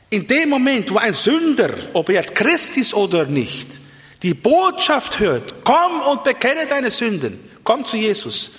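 high-pitched, emphatic pulpit proclamation; impassioned preaching. Real, not acted. Preacher calls sinners to come and confess, impassioned preaching. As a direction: preach it urgently, voice lifted well up.

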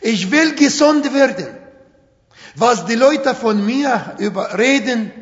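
ordinary talk, nothing marked

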